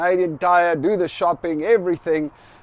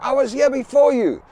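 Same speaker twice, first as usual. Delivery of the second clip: higher, louder snapping back; imitating the aggressive owner; aggro retort; 8 semitones higher, 4 dB louder than usual. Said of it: "this guy is just super aggro".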